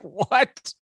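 high, stunned what; retold shock.

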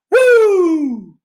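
high, loud ring-style woo yell; wrestler's woo. Acted. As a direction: let out a big wrestling woo.

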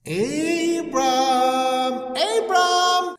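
high, drawn-out call from afar; a high, distant divine call; God calling out. Retold or imitated. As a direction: call his name like a distant voice.

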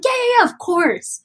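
high-pitched, quick-to-agree crew member; breezy agreement. Retold or imitated. Crew letting the singer warm up, breezily agreeing. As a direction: imitate someone airily waving a request through.

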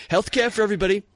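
mocking a canned campaign answer; Bernie Sanders impression. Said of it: "his exact verbiage".

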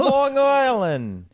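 New York accent. Shown as a demonstration. Showing off his accent to friends, slow loud New York accent. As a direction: drag out Long Island like a New Yorker. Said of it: "that was close".

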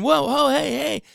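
high, eager whoa calls; excited dog hype. Shown as a demonstration. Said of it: "getting excited and getting pumped up".

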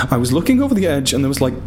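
fast, strained attempt at calm; forced calm. A narrator's reading. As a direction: rush it while trying to stay calm. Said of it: "I explained as calmly as I could".

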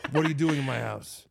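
ordinary talk

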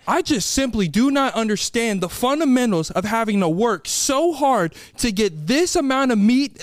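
ordinary talk

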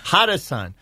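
normal talking voice

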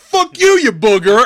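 very loud, high mock outburst; angry insult. Riker retold shoving the moon-faced guy, shouted insult. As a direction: yell it as a hammed-up insult.

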